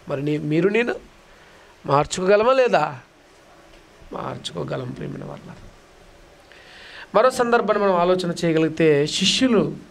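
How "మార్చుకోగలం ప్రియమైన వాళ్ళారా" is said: very soft, hushed reassurance; hushed pastoral comfort; soft assurance. Real, not acted. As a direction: breathe it gently to the congregation. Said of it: "soft assurance to congregation".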